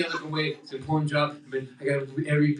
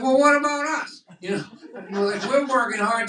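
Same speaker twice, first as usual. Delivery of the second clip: complaining organs; 9 semitones higher, 3 dB louder than usual. Retold or imitated. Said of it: "my lungs and my kidneys complaining".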